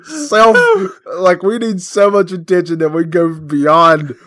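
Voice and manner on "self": slow and high, laughing. Real, not acted. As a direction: draw it out through laughter. Laughing at themselves.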